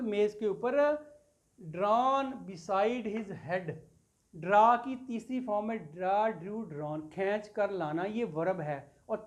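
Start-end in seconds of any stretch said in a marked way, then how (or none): 1.7-3.7 very slow, drawn-out reading of the verse; reading poetry aloud to a class; slow poem recital. Shown as a demonstration. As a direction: stretch each word of the line. Teacher reciting a poem line.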